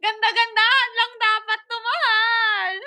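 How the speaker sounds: half-crying complaint; tearful plea. Retold or imitated. Complaining to partner that the swing was meant for pretty photos, tearful plea. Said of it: "Sabi ko".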